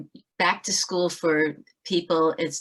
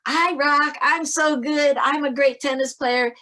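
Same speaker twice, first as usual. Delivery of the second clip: much higher and louder bragging; gloating player; 9 semitones higher, 6 dB louder than usual. Retold or imitated. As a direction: crow about a point you didn't earn. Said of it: "player B is on the other side going".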